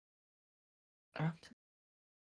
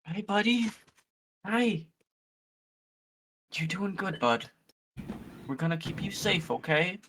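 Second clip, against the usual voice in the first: gentle reassuring voice; 4 semitones higher than usual. Acted. Comforting the egg creature.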